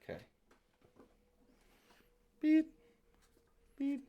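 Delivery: ordinary talk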